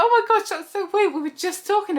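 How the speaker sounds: high excited squeal of surprise; surprised squeal. Retold or imitated. To best friend's mum, a high surprised squeal. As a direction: gasp it out as a shocked friend meeting her mum.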